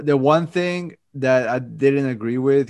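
usual speaking voice

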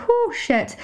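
a sharp high breath out to steady herself; shaky exhale. Real, not acted. Host pulling herself together after crying, high breathy exhale. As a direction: blow out the tears and regroup. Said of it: "one sec".

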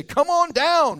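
game-show host's shout; big excited show call; Bob Barker. Retold or imitated. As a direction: call a contestant down, high and loud. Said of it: "Bob Barker at The Price is Right".

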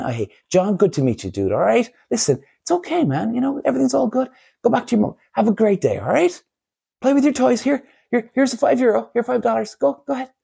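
put-on high, friendly child-directed voice; kindly to a child. Adult sends little John back to mum, kindly. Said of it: "show the kid a bit of love, right? And a bit of care".

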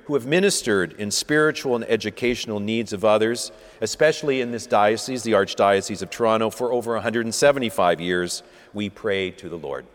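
steady liturgical reading, each petition falling to its close; intercession prayer. Acted. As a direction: lead the congregation's petitions calmly. Leads prayers of the faithful.

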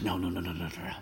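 low, quiet mumbled retold refusal; muttered refusal.

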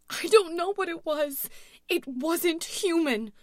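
high, loud, frantic denial; panicked scream. A narrator's reading. Louise describing her attacker to dispatcher, screaming. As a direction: cry it out in horror. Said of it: "screamed into her phone".

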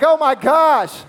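high, slow, mock-panicked gasp. Preacher imitating a panicking believer, mock panic. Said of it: "you're like".

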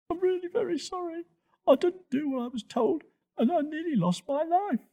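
very high, small contrite voice; little youngster owning up, close to tears; tearful apology. A narrator's reading. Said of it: "Toto looked down and cried a little".

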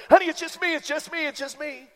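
high, hurried plea, loud at first; mock panicked pleading. Husband behind the door to startled wife, mock panicked pleading.

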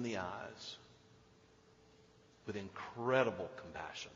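very soft, low, reverent storytelling; tender, near-whispered scripture telling; hushed preaching. Acted. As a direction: let the silence hang between phrases. Hushed telling of Jesus and woman.

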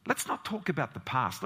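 normal talking voice

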